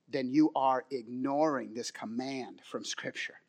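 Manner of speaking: hushed sincere preacher's appeal; quiet earnest plea. Acted. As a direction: lean in close and plead gently.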